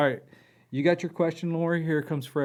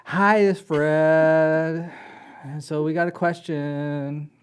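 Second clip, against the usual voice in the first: higher, louder, slow drawn-out oracle voice; Fred voice; 3 semitones higher, 7 dB louder, slower than usual. Acted. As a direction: speak as a slow booming spirit oracle. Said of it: "do you need Fred to have a different voice than Zoltar".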